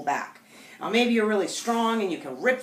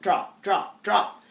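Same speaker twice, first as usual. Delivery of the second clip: frustrated repeating; much slower than usual. Shown as a demonstration. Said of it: "start getting that frustrated voice tone".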